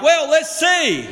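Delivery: a crowd member's skeptical jeer; mocking bystander. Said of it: "So they're like".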